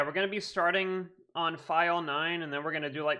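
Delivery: ordinary delivery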